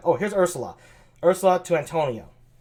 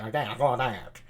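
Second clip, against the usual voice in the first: shunny voice; 6 semitones lower, 7 dB quieter than usual. Shown as a demonstration. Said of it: "maybe like a sh-, like a shunny".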